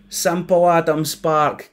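slow, sneering mimicry of the name; bully mocking Sparky's name, slow and sneering; mocking repeat. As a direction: drag out Simple like a taunt. Bully mocks his name.

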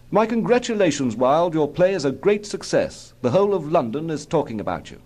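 high-pitched royal toast; congratulating Prince. A narrator's reading. Prince toasts Wilde on his play, congratulating.

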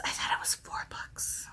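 a quick whispered self-check, unsure; softly whispered, second-guessing herself; whispered aside. Real, not acted. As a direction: whisper it to yourself, puzzled. Muttering doubt to herself.

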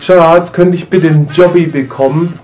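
high, loud wheedling plea; pleading to partner. Pretend. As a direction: beg sweetly and loudly. Acts asking partner for favour.

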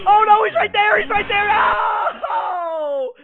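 frantic high yelling in panic; panicked screaming. Real, not acted. Panic during chase.

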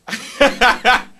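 loud, high cackle; burst of laughter. Real, not acted. Cracks up over his word choice.

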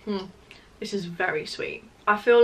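chewing while talking; talking between bites; mouth full of cake. Real, not acted. Seen 1: Bites cake at -4, chews while talking; from 2.8 holds can, sips later.

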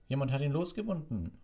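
high-pitched irritated complaint; grumpy snapping. A narrator's reading. Executioner realising someone freed the beast, grumpy. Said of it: "raunzte der Henker".

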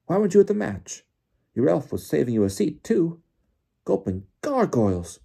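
high, loud, bouncing exclamations; excitable, swooping character voice; Bagman excited. A narrator's reading. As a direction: blurt it all out at high pitch. Bagman's excited chatter to Crouch.

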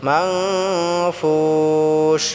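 মানফুস with the n hummed through the nose, sung long; nasal ghunna. Shown as a demonstration. Teacher shows the nasal ghunna to students, chanted slowly. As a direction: hum the n of মানফুস, then draw out ফুস. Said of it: "নাক দিয়ে গুন্নাটা বাহির করতে হবে".

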